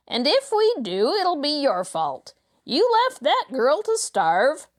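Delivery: high-pitched, rough thug's complaint; whining accuser. Acted. Robber blaming the leader, quarrel.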